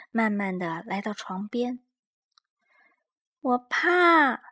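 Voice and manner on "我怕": high, small timid cub voice; tiny scared bear cub; Little Bear voice. A narrator's reading. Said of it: "小小熊说。".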